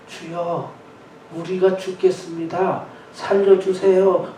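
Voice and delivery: soft, weak mock prayer; deliberately half-hearted cry for help; parched, feeble plea. Shown as a demonstration. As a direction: plead limply, as if with a dry throat. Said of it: "뭐 이렇게 목이 마른 목소리로 했겠습니까?".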